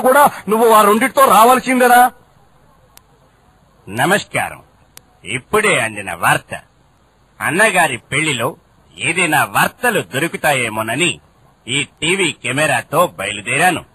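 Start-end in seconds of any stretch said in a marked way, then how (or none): 3.9-13.8 deep, soft formal news announcer; newsreader voice. Acted. Reporter greeting the boss in his news voice. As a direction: read it like a TV news bulletin. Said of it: "ఎక్కడికెళ్ళినా ఆ భాష మాత్రం వదలవా".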